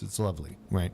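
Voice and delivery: usual speaking voice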